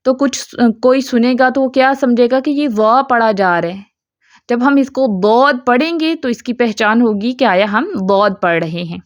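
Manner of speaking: ordinary talk, nothing marked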